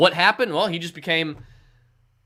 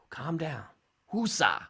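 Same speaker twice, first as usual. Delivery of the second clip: calming down; 8 dB quieter, slower than usual. Acted. Soothing impatient fans.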